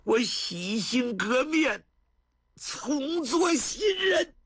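drawn-out, high shouted pledge; remorseful son swearing to his grandfather, high slow shout; impassioned vow. A narrator's reading. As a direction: cry out the vow slowly, high. Xue Dingshan vows to reform.

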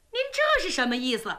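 ordinary delivery, nothing marked

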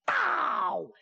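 high, falling drawn-out boom; long vocal boom sound effect; imitated cannon boom. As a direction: mimic a signal cannon from the hills.

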